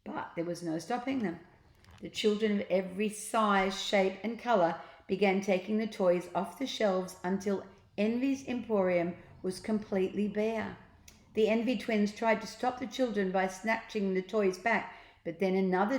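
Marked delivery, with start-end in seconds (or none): none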